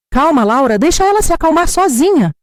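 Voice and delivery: a poisonous sweetness; sweet and venomous. Acted. Daughter-in-law brushes off the family's panic, sweet and venomous. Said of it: "com aquela voz doce e venenosa".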